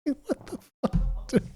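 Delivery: breathy unvoiced disbelief, slow; exasperated whisper. Real, not acted. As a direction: drop to a hushed what the fuck. Exasperated at the argument.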